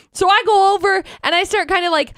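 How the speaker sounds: usual speaking voice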